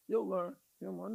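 lightly voiced knowing elder; lightly voiced, laid-back and knowing; soft, amused elder lecturing a young one; older person schooling youth. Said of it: "older person schooling".

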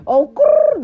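high, loud mock growl; a sassy little growl; playful growl. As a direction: growl like a teasing cat. Growl cutting off a cuss.

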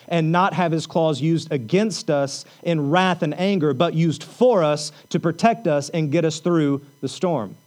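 rising pulpit fervor; preaching at full force. Acted. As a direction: drive it home to the congregation.

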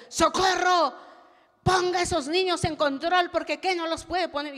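loud, high, commanding rebuke; stern pastor's scolding. Retold or imitated. The pastor scolding Socorro to control her children, loud and stern. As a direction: bark the order like a strict pastor.

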